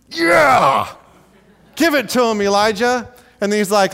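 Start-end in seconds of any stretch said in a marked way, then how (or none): 0.1-0.9 high, loud whooping "yeah"; shouted cheer. Acted. Preacher cheers on Elijah's taunt. 1.8-3.1 high, loud egging-on; rousing cheer. Pretend. Preacher cheering Elijah on.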